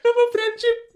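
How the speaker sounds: ordinary talk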